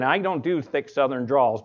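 normal talking voice

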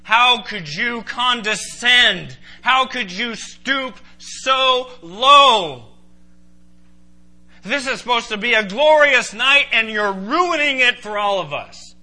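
loud, high, appalled reproach; outraged protest at full voice; incredulous Peter. Acted. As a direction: stretch out the disbelief, then scold. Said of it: "Peter was incredulous".

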